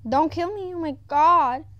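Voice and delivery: high, loud frightened plea; shrieking don't kill me; panicked plea. Real, not acted. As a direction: beg for your life, panicking. Fearing being killed in game.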